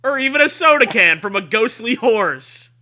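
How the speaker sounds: loud, high spooky trailer voice; hammed-up promo shout. Host shouts the blurb like a horror trailer. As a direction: belt it like a campy horror trailer.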